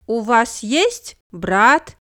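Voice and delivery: ordinary talk, nothing marked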